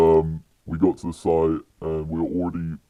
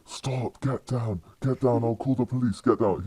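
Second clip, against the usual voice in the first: high-pitched mock yell at normal volume; imitated yelling guard; 6 semitones higher than usual. Security guard yelling at climbers on the hoist, imitated high mock yell. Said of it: "The security guard's yelling".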